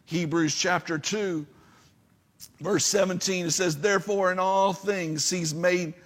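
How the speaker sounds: loud, high, proclaiming sermon voice; full-force preaching. Acted. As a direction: preach the psalm out to the congregation.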